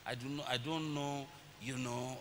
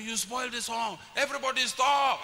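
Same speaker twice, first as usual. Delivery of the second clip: high, rising to a loud shout; mock outburst, building to a shouted order; angry child choirmaster; 7 semitones higher, 7 dB louder than usual. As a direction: imitate a cross boy halting the choir. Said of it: "He will get very angry".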